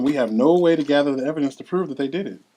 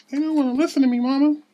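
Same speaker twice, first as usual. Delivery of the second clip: put-on higher, louder little girl pleading to her mother; a young daughter complaining to her mama, higher and louder; child's voice; 14 semitones higher, 5 dB louder than usual. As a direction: play a frustrated young daughter, pitched up.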